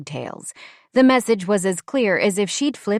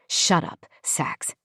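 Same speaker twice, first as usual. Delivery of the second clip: a quick hiss to keep him quiet; voiceless whispered warning; hushed whisper; 3 dB quieter, slower than usual. Acted. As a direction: whisper it sharply under the breath. Hushed warning in a tense scene.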